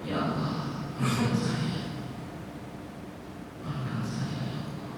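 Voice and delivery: breathy whispered prayer, almost voiceless; whispered plea. Retold or imitated. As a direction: beg God under your breath. Re-enacting his plea. (bisikan doa memohon)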